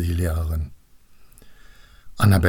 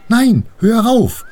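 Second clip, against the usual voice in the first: high, loud, drawn-out objection; indignant protest; 14 semitones higher, 7 dB louder, slower than usual. Acted. Annabel protests at being splashed, high and indignant.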